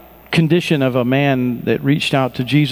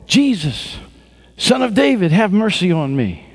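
raised pleading call; shout; 7 semitones higher than usual. A narrator's reading. Blind Bartimaeus calling out to Jesus, pleading cry. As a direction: cry out to Jesus across the crowd. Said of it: "he began to shout".

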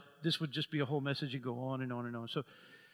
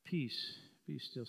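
soft, falling "Peace, be still"; quiet command; 5 semitones lower, 8 dB quieter, slower than usual. Shown as a demonstration. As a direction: speak calm over the storm gently. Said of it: "Say it to the atmosphere".

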